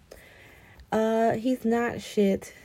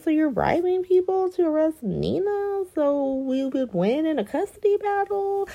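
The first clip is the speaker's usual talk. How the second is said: higher, pointed quoting of Willow; mock Willow confronting; 10 semitones higher than usual. Willow confronting Michael over the bribery, mock accusing. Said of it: "She's like".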